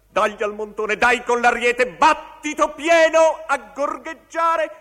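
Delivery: high, loud, mounting theatrical cry; battering shouts. Acted. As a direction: drive it up to a full shout. Battering climax of the recitation. (grida incalzanti e acute)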